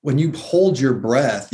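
ordinary talk, nothing marked

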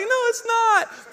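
high, drawn-out comic objection; mock protest. Husband answering wife in the cold, mock protest. Retold retort amid crying kids.

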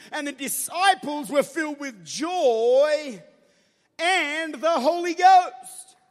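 loud sermon, verses stretched slow; fervent preaching. Acted. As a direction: lean into each verse like a preacher.

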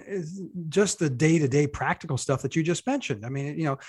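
normal talking voice

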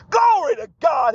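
joyful preacher's shout; shouted praise. Real, not acted. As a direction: shout it out to God. Preacher testimony, sudden praise.

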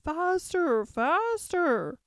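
high, loud urging cry. A narrator's reading. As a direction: call out high, drawing each word out. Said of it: "the queen kept crying".

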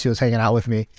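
ordinary delivery

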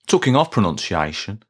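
ordinary talk, nothing marked

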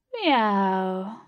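loud, drawn-out falling meow; wailing cat. A narrator's reading. Blue answering Katie's call, wailing. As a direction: wail it like a cat. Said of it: "wailed Blue in reply".

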